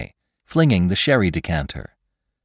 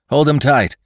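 high, loud urgent call; cried order; 8 semitones higher, 5 dB louder than usual. A narrator's reading. Victim orders the men holding his attacker, urgent cry. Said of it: "cried McClure".

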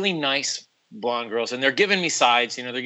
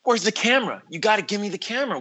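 higher, quick excited demand; eager; 8 semitones higher than usual. Retold or imitated. Asking the crew for the camera, higher eager rush. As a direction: grab for the camera like a prop. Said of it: "And I was like".

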